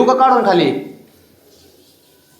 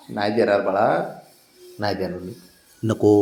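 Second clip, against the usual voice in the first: low, much softer gentle no to the pet; soft refusal; 8 semitones lower, 7 dB quieter than usual. Real, not acted. To the pet bird asking for food, a low, soft refusal. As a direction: soothe the pet while refusing it.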